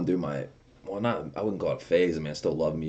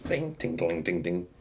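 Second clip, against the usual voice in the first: imitated pings. Imitating candy hitting bumpers.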